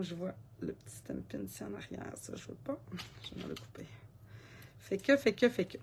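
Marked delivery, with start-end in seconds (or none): 0.0-3.9 barely voiced whisper to herself while working; whispered aside. Real, not acted. Crafter whispering to herself as she trims the pencil, hushed. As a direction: murmur it under your breath, absorbed in the task.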